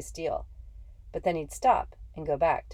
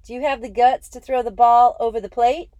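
loud challenging yell; 7 semitones higher, 11 dB louder than usual. Acted. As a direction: shout the dare across the field. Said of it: "Jackie yelled at Schoolboy Rowe".

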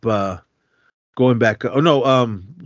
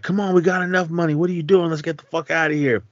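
high, fast, impatient urging; hurried urging; 7 semitones higher, faster than usual. Retold or imitated. Ashley urging the gang to leave, hurried. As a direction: rush them out the door.

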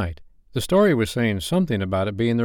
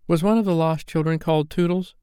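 put-on high, eager child's question; crying out; 9 semitones higher than usual. A narrator's reading. Toodles asking Wendy about the story, crying out. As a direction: ask it in an excited high voice. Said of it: "cried Toodles".

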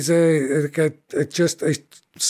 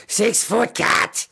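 slow, higher-pitched disbelieving creature voice; indignant kobold; 5 semitones higher, much slower than usual. Acted. Freed kobold grumbles about the giant cat, indignant. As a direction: draw it out in disbelief.

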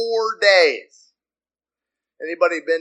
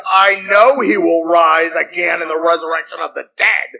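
impatient answer; 7 dB louder than usual. Acted. Acting Martha answering Jesus impatiently.

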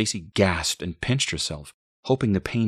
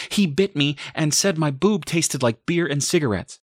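high, louder distressed outburst; frantic blurting; 10 semitones higher, 5 dB louder than usual. A narrator's reading. Mother blurts out to boyfriend, high frantic outburst. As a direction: blurt it out before being stopped.

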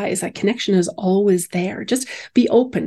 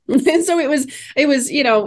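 normal talking voice

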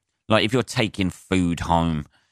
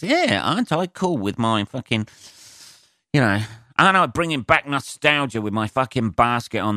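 higher, sneering hipster impression; mocking show-off; 4 semitones higher than usual. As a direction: parody a smug cyclist showing off.

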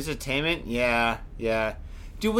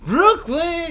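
Brooklyn called out high and loud; shouted Brooklyn; 12 semitones higher, 5 dB louder than usual. Shown as a demonstration. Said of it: "there we go".

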